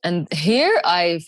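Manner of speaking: ordinary delivery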